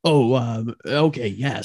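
low, hesitant dad voice; deep, sheepish father; father figure. Acted. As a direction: agree haltingly in a deep register. Father interrupted by bandmate.

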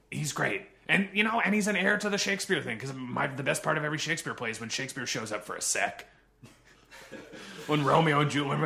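ordinary talk, nothing marked

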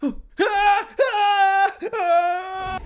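high, loud shrieks of terror; gasp then terrified screams. Acted. Chris screams on learning he talks to a ghost. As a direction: scream again and again in panic. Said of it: "a full hour of screaming".

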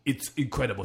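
ordinary talk

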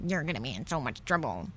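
put-on low, quick, muted kid's taunt; mock childish taunt. Two kids waiting for the principal trade rude words, low quick taunt. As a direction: toss off a low, hurried playground threat. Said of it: "said rude things to each other".

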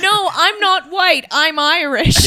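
high, loud, drawn-out mock protest; mimicking an insistent heckler's shout; imitated yelling. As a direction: yell it slowly like an indignant heckler. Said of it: "she kept yelling that".

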